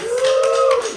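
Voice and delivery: very high, loud whoop; excited whoop. Real, not acted. Host cheers the show title, high loud whoop. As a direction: let out a piercing cheer.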